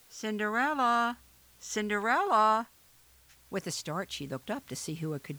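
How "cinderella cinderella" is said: lightly voiced calling from nowhere; calling from afar. A narrator's reading. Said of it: "she heard a voice calling".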